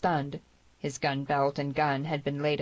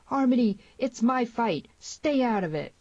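high, loud urgent yell; 8 semitones higher, 4 dB louder than usual. A narrator's reading. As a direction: yell a warning on the run. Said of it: "and yelling".

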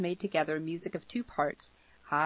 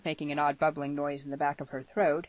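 ordinary delivery, nothing marked

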